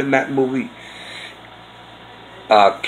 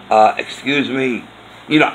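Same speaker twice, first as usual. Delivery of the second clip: usual speaking voice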